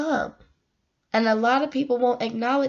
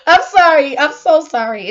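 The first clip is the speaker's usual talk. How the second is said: laughing apology; 5 semitones higher, 9 dB louder than usual. Real, not acted. Apologizing after saying shut up.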